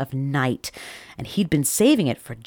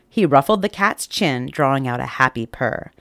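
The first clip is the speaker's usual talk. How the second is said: ordinary delivery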